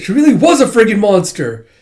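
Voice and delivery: high, loud pleading; desperate insistence. Retold or imitated. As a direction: make them believe it. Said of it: "It's like".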